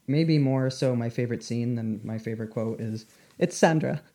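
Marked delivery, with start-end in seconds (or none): 3.4-4.0 excited Italian. Retold or imitated. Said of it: "just an excited Italian".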